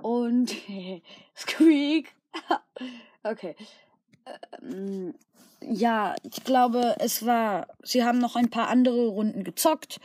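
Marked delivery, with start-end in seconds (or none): none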